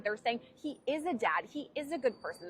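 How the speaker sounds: ordinary talk, nothing marked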